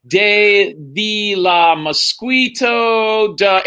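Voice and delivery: very slow, stumbling nonsense Spanish; halting, dragged-out fake Spanish syllables; fumbled mock Spanish. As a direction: sound out words you can't read. Fumbling Spanish reading aloud.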